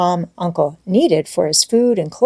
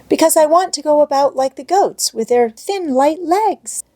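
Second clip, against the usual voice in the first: put-on higher, bright child's voice full of longing; eager little girl Heidi; 9 semitones higher than usual. A narrator's reading. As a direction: play the excited little girl.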